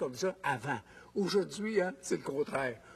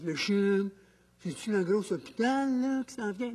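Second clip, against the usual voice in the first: mistaken questioner. Retold or imitated. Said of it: "il y en a dit".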